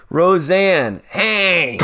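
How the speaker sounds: high, badly faked Roseanne voice; horrible Roseanne. Comic attempting Roseanne for the cohost, high and horrible. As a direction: call out in a bad sitcom-mom impression. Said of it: "Horrible voice".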